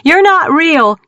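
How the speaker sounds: high, loud shout. A narrator's reading. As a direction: scream it again. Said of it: "Mackenzie shouted".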